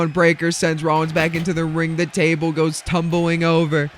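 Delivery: ordinary talk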